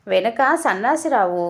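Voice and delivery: ordinary delivery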